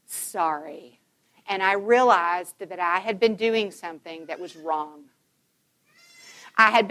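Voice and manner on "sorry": much softer, slow, heavy with remorse; barely-there, ashamed apology; remorseful apology. Retold or imitated. Mother apologizing to her hurt son, quiet and remorseful. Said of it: "I was under incredible conviction".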